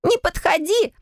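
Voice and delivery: high panicked squeal; frightened shriek. Acted. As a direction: shriek to keep her away. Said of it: "завизжала девушка".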